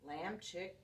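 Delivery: ordinary delivery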